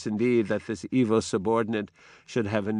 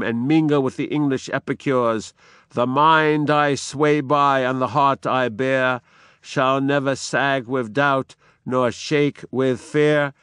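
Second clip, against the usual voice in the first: defiant declamation; 2 semitones higher, 4 dB louder than usual. Acted. Macbeth vowing he will never fear, firm declamation. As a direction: vow it without doubt. Macbeth's vow read aloud.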